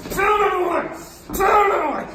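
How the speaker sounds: loud, high repeated cries; wild blow-by-blow yell. Retold or imitated. Comic wailing away on God, high loud yelling. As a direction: yell it with each blow landed.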